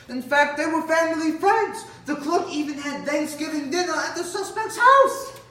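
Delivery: high, loud disbelief; incredulous outburst. Acted. Cop learning clerk knew the suspect, loud disbelief. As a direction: build it to a high, loud peak.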